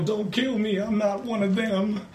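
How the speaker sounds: high, slow fake Southern drawl; mock Southern accent. Friend mimics Hans Gruber faking a Southern accent to beg. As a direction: squeak it out like a begging hostage in a put-on Southern accent. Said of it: "he uses the, the Southern accent".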